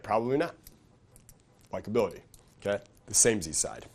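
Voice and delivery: ordinary delivery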